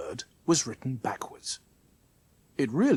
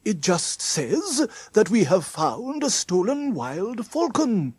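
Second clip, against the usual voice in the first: slow, higher character voice; Mister Trim explaining; 6 semitones higher, 4 dB louder than usual. Acted. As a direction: explain it slowly in a raised, animated voice.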